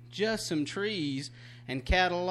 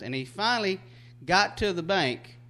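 normal talking voice

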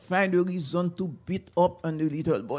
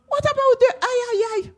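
put-on high, hurried child's voice; small boy's voice; faster than usual. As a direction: rattle off the list like a boy. Boy asks old lady.

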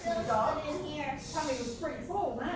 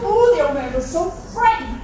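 frightened; 5 semitones higher, 13 dB louder than usual. A narrator's reading. Narrating the old man's fright.

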